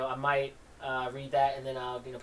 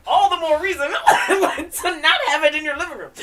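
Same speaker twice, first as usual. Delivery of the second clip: laughing aside; 13 semitones higher, 10 dB louder than usual. Real, not acted. Laughing aside inside quote.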